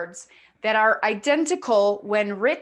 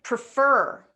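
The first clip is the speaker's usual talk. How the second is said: "Prefer" with the "fer" longer and louder; second-syllable stress; 5 dB louder than usual. Shown as a demonstration. Teacher showing students where the stress is, second syllable stressed. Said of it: "we're gonna stress this part here".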